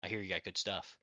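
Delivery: hushed. Acted. Nervous buyer whispering to dealer.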